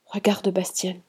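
soft, hushed aside; whisper. A narrator's reading. Luna alerts those beside her, whispering. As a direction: drop the volume, confide it discreetly. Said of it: "elle nous chuchota".

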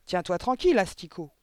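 loud, angry order; growled. A narrator's reading. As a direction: snap at the squirming pupil. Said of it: "grogna mademoiselle Le Gourdin". (ordre furieux)

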